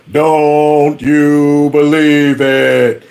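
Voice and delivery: low, very slow put-on drawl; cartoon character voice.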